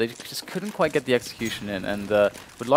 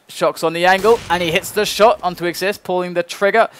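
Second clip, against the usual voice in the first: rising, excited play-by-play; esports caster; 5 semitones higher, 5 dB louder than usual. Real, not acted. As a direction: build the call up high and loud. Live play-by-play rises to peak.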